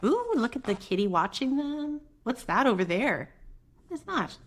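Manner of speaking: plain reading voice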